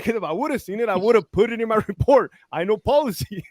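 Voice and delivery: high-pitched mock protest; hammed-up indignant excuse; indignant self-defence. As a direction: defend yourself with acted exasperation. Acted officer defending himself.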